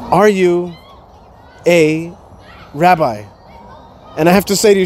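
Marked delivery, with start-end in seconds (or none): 0.1-3.2 slow, halting question, word by word; spaced-out, careful question; quoted woman's question. Retold or imitated. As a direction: ask it haltingly with long pauses between words. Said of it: "a woman comes up to me and she says to me".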